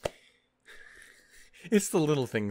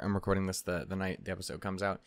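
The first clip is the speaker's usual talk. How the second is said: ordinary talk, nothing marked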